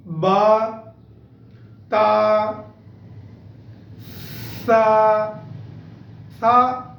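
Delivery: sa said without any whistle; Arabic pronunciation. Shown as a demonstration. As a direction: demonstrate ba ta sa clearly and slowly. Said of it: "for better pronunciation".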